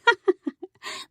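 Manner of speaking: high, eerie falling laugh; a ghost's light, wavering laugh; ghostly laugh. A narrator's reading. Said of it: "her grandmother laughed".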